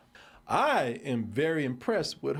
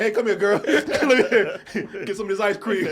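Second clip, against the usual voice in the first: laughing, louder and higher mock come-on; mock creepy villain; 7 semitones higher, 8 dB louder than usual. Podcaster acting out the Kingpin scene, laughing. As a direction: ham up the villain through giggles.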